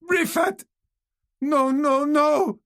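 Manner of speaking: very high, loud panicked cry; terrified scream. A narrator's reading. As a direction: scream in horror at the name. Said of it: "Tesina screamed".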